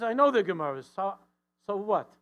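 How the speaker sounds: loud, high so what; 1 dismissive shout. Retold or imitated. Husband dismisses wife's books, loud and impatient.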